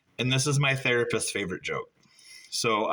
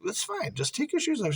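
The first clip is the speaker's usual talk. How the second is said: coaxing; 14 semitones higher, 3 dB quieter than usual. Retold or imitated. Coaxing girlfriend in retold scene.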